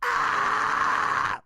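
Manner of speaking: high unvoiced scream pushed from the diaphragm; whispered scream. Shown as a demonstration. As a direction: push a breathy scream from the belly. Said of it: "now we're gonna push from the diaphragm".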